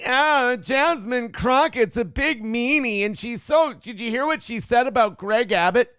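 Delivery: mocking whiny voice. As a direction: pout it out like an offended conservative. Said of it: "conservatives now are going".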